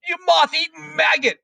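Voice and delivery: very high, strident scolding; enraged headmistress demanding an answer; yelled tirade. A narrator's reading. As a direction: rant at the pupil in a high, furious voice. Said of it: "yelled the Trunchbull".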